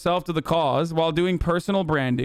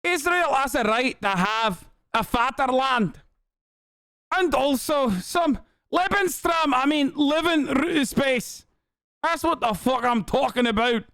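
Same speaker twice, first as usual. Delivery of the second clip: high, mocking Scottish caricature; ad-lib as her in a high-pitched Scottish accent; exaggerated Scottish accent, far above own pitch; Eve Fartlow impression, Scottish accent; 9 semitones higher than usual. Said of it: "Is that a Scottish accent?".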